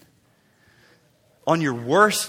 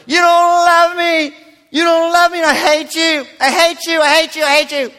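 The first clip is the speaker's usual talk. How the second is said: put-on kid's tantrum; 9 semitones higher, 6 dB louder than usual. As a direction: yell it like a kid slamming the door. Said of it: "kids have slammed the door and said".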